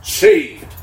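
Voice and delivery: "Saved" called out high and loud; headline cry. Retold or imitated. Preacher voicing a rescue headline, proclaiming. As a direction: announce it like a headline. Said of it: "the headline will say".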